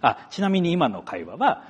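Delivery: ordinary talk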